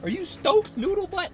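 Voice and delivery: high, sing-song voice for the dog; squeaky, slow pet-talk to a pup. Real, not acted. Talking baby talk to his dog.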